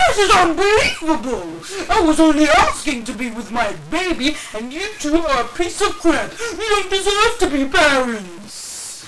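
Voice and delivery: gasp, then high loud shrieking rant; scandalized, spitting out insults; hammed-up Karen meltdown; outraged entitled mom. Entitled mom gasps and rants.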